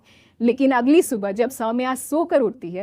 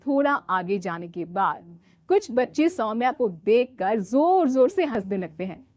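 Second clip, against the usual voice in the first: usual speaking voice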